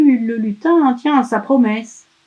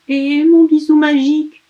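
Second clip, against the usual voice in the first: put-on high, louder child plea; asking elf; 7 semitones higher, 5 dB louder than usual. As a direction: ask hopefully like a little boy. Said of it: "le lutin réclame".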